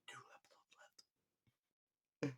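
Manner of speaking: "Two episodes" breathed in a voiceless whisper. Shown as a demonstration. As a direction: whisper it like a secret aside. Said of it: "repeats it in a whisper".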